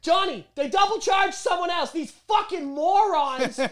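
high-pitched, hammed-up outrage; exasperated rant. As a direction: rant to a coworker behind the mute button. Muted rant to coworker Johnny.

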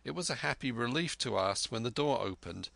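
ordinary delivery

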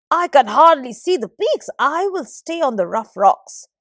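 loud, high-pitched grumbling plea; sky begs his son, loud and complaining. Acted. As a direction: protest to stay on the rocks. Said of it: "complained the sky".

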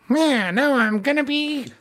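very high, slow squawky villain voice; Garlic Junior. Acted. Imitating Garlic Junior's dub voice from memory, high villain boast. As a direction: draw it out in a high cartoon villain voice. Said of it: "I remember Garlic Junior's voice being, like, very, like".

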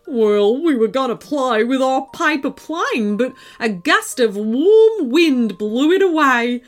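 a small animal glumly telling its tale; potaroo voice. A narrator's reading. Potaroo explaining the lost paper plane to the girls, lightly voiced. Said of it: "and the potaroo said".

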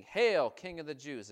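mock homage, loud and sarcastic; mocking hail. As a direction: sneer it out like a soldier bowing in jest. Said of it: "they mocked him".

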